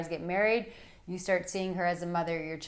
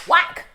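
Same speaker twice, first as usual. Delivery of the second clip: loud sharp "whack" for effect; sudden loud whack; mock smack sound; 9 semitones higher, 13 dB louder than usual. Shown as a demonstration. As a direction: slam the word out like a slap.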